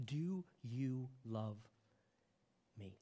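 very slow and soft, each word drawn out; slow intimate question. Acted. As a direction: ask it slowly, eye to eye.

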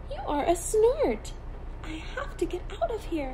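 high, fast, scared chatter; scared bird wants out; panicked chick. A narrator's reading. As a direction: gabble it out in a panic. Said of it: "said the baby bird".

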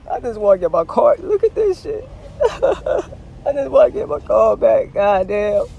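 loud, high hammed-up whining; mock crying. Driver who just wants his car back, mock crying. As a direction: wail it like a mock sob, breaking into laughs. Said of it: "I'm about to cry".

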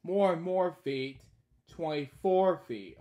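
lively sing-song read-aloud; storybook reading. Acted. Reading a bedtime story aloud, lively. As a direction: read each line brightly to a child.

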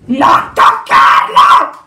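loud, drawn-out warning yell; alarmed shout. Acted. Lars warns a friend not to touch him, alarmed shout. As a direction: shout it slowly and forcefully.

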